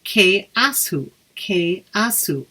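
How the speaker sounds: very slow "Ke asu"; teacher modelling "Ke asu" slowly; Irish phrase demo. Shown as a demonstration. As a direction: stretch out "Ke asu" syllable by syllable. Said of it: "a phrase that says".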